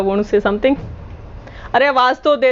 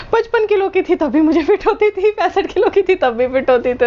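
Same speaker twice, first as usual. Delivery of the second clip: laughing; 9 semitones higher than usual. Real, not acted. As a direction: giggle through the boast. Laughs about her weight.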